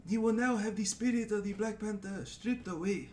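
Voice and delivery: solemn Wakandan ritual proclamation; Wakandan accent. Shown as a demonstration. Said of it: "I always do that voice".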